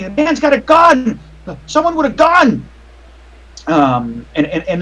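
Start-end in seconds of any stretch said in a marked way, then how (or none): 0.0-2.7 panicked crowd screaming. Acted. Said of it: "people screaming".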